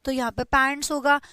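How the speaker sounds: normal talking voice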